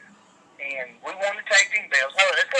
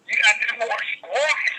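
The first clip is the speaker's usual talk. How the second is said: higher, matter-of-fact delivery; mock know-it-all advice; 7 semitones higher than usual. Dude telling the concussed wrestler to squat, mock advice. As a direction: hand out the advice like it's obvious. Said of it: "And he goes".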